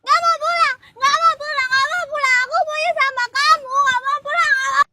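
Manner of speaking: loud, high repeated protest; high-pitched wailing protest; tantrum refusal. Acted. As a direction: refuse insistently at high volume. Refuses to go home.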